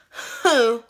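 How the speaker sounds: ordinary talk, nothing marked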